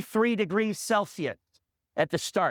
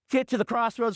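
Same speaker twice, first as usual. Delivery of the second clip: ordinary talk, nothing marked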